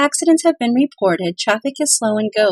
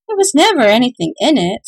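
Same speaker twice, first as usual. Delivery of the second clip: louder, dismissive scoff; 4 semitones higher, 5 dB louder than usual. Acted. Old man scoffing at the empty purse, loud and dismissive. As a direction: brush it off with scorn. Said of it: "scoffed an old man".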